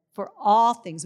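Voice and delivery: proclaiming loudly. Real, not acted. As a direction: ring out the words all things like a declaration. Declaring scripture to congregation.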